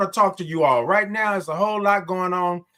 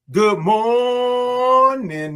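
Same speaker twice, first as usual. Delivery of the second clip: high, loud, long-held morning call; drawn-out greeting; 6 semitones higher, 5 dB louder, much slower than usual. Real, not acted. Host greets Mississippi, loud and drawn out high. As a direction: belt the O and hold it. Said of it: "I love all those O's and R's".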